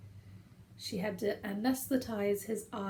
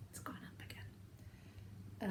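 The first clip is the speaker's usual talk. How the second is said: whisper; 14 dB quieter, much slower than usual. Real, not acted. Whispered aside about cat's weight.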